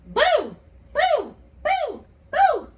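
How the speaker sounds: high loud spooky boos; monster boo. Acted. Teacher voicing the monster scaring children, high boos. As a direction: try to scare the kids with boos. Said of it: "the monster saying".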